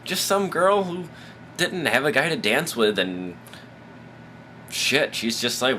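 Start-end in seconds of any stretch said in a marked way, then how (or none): none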